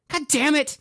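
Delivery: high, loud shriek of a curse; shrill curse. A narrator's reading. Cassidy shrieking after tripping, high and loud. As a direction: shriek it out in pain. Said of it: "Cassidy shrilled".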